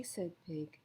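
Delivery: plain reading voice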